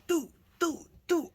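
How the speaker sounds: short, high staccato hits; imitated punch noises. Storyteller showing how they busted a lip, high hit noises. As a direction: punch out each syllable high.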